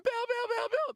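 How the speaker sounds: put-on high-pitched, squealing little kid fan. Kid fan begging Bill to sign, high squeal. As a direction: gush like an overexcited kid begging for an autograph. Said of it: "he went from".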